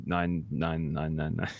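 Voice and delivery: ogre voice. Acted. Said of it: "ten and then ogre voice".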